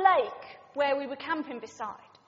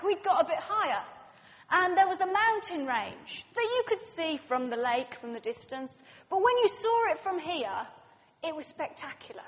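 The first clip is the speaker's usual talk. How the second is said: usual speaking voice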